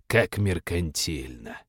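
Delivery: slow, low, soft sneer; disdainful sneer. Acted. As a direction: sneer slowly in disgust. Demon sneers at money.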